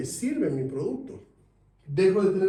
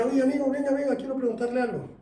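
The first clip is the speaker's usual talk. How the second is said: lightly voiced Colombian saleswoman, higher; a hint of a Colombian seller calling him over, pitched up; Colombian accent; 8 semitones higher than usual. Saleswoman calling a passer-by, light Colombian accent, higher. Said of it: "con acento colombiano".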